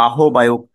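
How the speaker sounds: আহ্বায়ক said as a-ho-bayok; spelling reading, a-ho-bayok; mistaken pronunciation. Shown as a demonstration. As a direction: sound h and single b in আহ্বায়ক. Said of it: "উচ্চারণ করে থাকি অনেকে".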